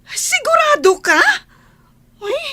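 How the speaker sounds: loud and high, disbelieving; stunned disbelief. Acted. Angie doubting the news about Hubert, loud shocked question. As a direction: fire the question back in shock.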